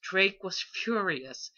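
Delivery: plain reading voice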